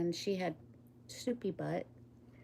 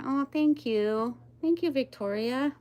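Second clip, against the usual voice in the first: touched thanks; 6 semitones higher, 5 dB louder than usual. Real, not acted. Thanking a viewer for praise.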